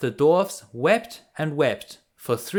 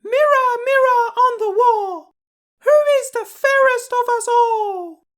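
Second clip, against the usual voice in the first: very high, louder wicked queen voice; the queen asking the mirror; 6 dB louder than usual. Acted. Wicked queen asks her magic mirror, very high louder queenly voice. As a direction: call on the magic mirror with pride. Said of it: "she asked her mirror".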